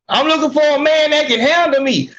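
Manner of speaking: lightly voiced sassy woman; mocking her voice. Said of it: "talk like this".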